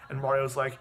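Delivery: usual speaking voice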